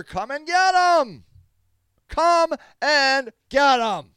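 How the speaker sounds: slow, high, loud dare; shouted challenge. Real, not acted. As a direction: yell it slowly, word by word.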